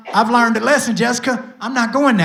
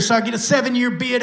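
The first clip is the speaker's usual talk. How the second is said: usual speaking voice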